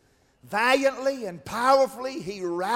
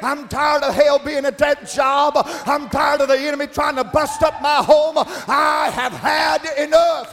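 loud preacher's plea, climbing higher as it builds; impassioned preaching cry; 5 semitones higher, 8 dB louder than usual. Acted. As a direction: cry out to God at full pulpit force.

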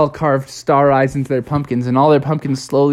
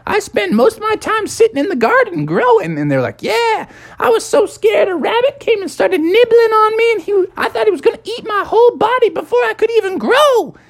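very high pumpkin, scared of the rabbit; a pumpkin telling friends about the nibbling rabbit, high and afraid; 3 dB louder than usual. Acted. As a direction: squeak out the fright. Pumpkins telling scary garden tales.

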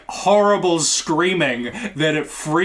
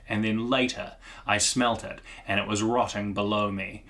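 low grave monologue voice; 5 semitones lower, 6 dB quieter than usual. Retold or imitated. Character monologue retold.

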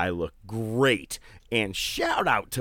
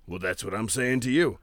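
character impression. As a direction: repeat it in his character voice. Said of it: "do his character".

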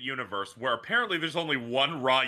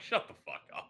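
hissed under the breath, much softer; whispered retort; 6 semitones lower, 10 dB quieter than usual. Retold or imitated. Rolling eyes at Phoenix, soft whispered shut up. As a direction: mutter it like an eye-roll aside. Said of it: "I'm like".

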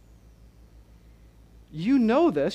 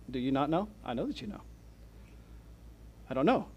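mock sarcasm; 3 semitones lower, 8 dB quieter, faster than usual. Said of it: "Kinda comes with a bit of sarcasm".

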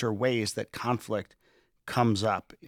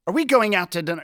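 much higher and louder, pointed and accusing; 9 semitones higher, 9 dB louder, faster than usual. Shown as a demonstration. Spouse asking about dinner, loud and high accusing tone. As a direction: throw the question like an accusation. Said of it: "it's a very different kind of tone".

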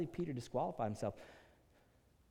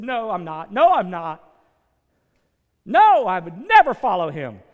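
shouted, rising denial, louder each time; loud panicked denial; 8 semitones higher, 22 dB louder than usual. Acted. Peter shouting his denial at his accusers, panicked. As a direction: deny it desperately, near yelling.

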